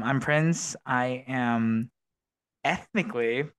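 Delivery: ordinary talk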